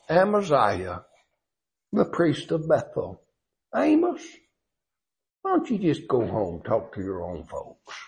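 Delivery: softly dismissive, looking down on him; condescending. Retold or imitated. Priest brushing off Amos, quietly condescending. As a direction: talk down to a country prophet. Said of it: "look at the condescending tone".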